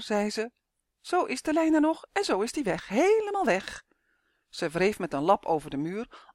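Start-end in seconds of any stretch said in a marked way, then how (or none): 1.0-3.8 high, teasing witch voice, sing-song; a mischievous little hag taunting lost travellers; giggling stone witch. A narrator's reading. Stone witch teasing the lost travellers, high and giggly. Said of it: "Een grijzig vrouwtje dat giechelde".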